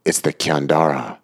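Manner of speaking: ordinary delivery, nothing marked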